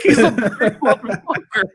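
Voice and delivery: high, loud, half-laughing disbelief; awed disbelief. Retold or imitated. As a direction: imitate him gasping in amazement. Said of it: "he was like".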